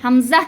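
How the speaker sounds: হামজা cut off with no drawl; হামজা clipped, no lengthening; short hamza. Shown as a demonstration. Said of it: "আমি হামজাতে কিন্তু কোনো টান দেইনি".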